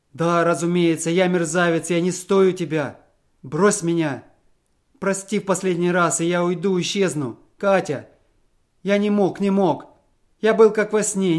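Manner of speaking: a wretch begging one last pardon; desperate pleading. A narrator's reading. Husband begs his wife Katya's forgiveness, desperate.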